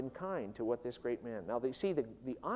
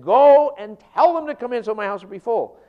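loud, high order; commanding master; 6 semitones higher, 15 dB louder than usual. Acted. Master ordering his servant out, loud and forceful. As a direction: bark the command outward.

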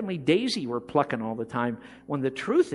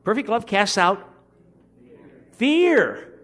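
rousing shout; 4 semitones higher, 5 dB louder, slower than usual. Shown as a demonstration. Preacher rousing a sleepy congregation to answer, shouting. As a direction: build to a loud, high "fear". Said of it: "That is really underwhelming".

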